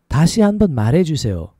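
syllables joined into one flowing phrase. Shown as a demonstration. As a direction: run it together at conversational speed. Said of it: "A little faster".

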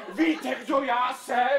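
loud, slow self-important announcement; loud slow pompous introduction; indignant pomp. Acted. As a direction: puff yourself up, offended.

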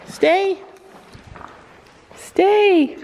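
firm stay. Real, not acted. Telling pony to stay.

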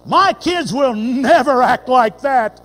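slow, loud, indignant parent; a slow, loud "My kids will never act like that" with stress on "My"; proud vow. Retold or imitated. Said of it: "the same tone of voice".

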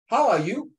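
"How are you" high with a rising end; question intonation. Shown as a demonstration. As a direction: lift YOU clearly as a question. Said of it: "So from the tone".

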